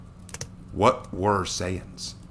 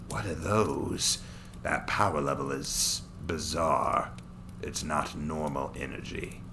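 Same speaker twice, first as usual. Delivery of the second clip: lower, slower, contemptuous villain voice; a cruel Saiyan amused by his prey; sneering villain; 4 semitones lower, 7 dB quieter, slower than usual. Acted. Daikon senses strange power.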